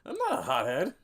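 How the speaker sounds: loud, high protest; high-pitched indignant outburst; indignant retort. Acted. Indignant reply to hothead jab.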